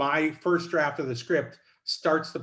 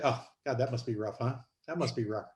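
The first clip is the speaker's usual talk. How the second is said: softer, understated sympathy; underplayed; 4 semitones lower, 6 dB quieter than usual. Writer quotes Ellen's line to a frustrated Marlon, underplayed. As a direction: undersell it, barely reacting. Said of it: "underplay it and undersell".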